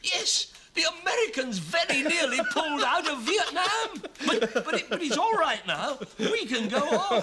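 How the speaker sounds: high, laughing sarcastic quote; mock the director's relief through laughter; laughing mockery.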